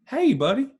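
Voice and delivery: high, bright motherly hello; mom's sweet greeting. Retold or imitated. Mom answering her son's call, sweet high hello. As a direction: coo it like a proud mom.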